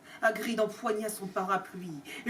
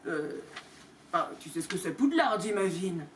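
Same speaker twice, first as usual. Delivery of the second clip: lower, hesitant giant; Hagrid's voice; 6 semitones lower than usual. Acted. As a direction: rumble it out low and unsure. (Hagrid hésitant, voix plus grave)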